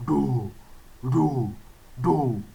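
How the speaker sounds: "Do" pushed low from the belly. Shown as a demonstration. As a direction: let "Do" come deep, tummy out. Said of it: "when we are pronouncing do sound".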